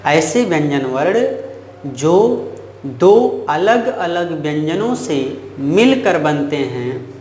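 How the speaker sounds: slow, measured definition in separate phrases; model recitation. Shown as a demonstration. Teacher repeating the definition for students, slow model recitation. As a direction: dictate it slowly, pausing between phrases. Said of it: "आप ऐसे कहोगे".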